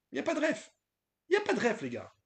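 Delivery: put-on Sentier accent, pitched high; hammed-up Sentier accent, high pitched. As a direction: play the Paris Sentier guy, high and mocking. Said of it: "J'ai pris l'accent du sentier".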